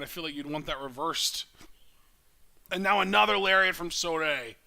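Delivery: lively live play-by-play call; wrestling commentator. Real, not acted. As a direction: call the match as it happens.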